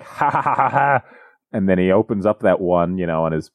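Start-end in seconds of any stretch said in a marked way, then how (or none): 0.0-1.0 imitated crowd laugh; mock jeering laughter. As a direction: schoolkids laughing at someone, acted out. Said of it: "everyone's like".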